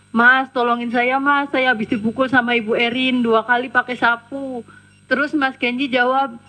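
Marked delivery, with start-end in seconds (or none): none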